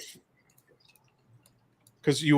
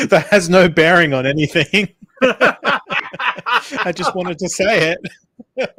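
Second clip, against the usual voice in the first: louder, laughing through the words; 6 semitones higher, 8 dB louder, slower than usual. Real, not acted. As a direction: giggle out the confession, slowing at the end. Laughing at his own joke.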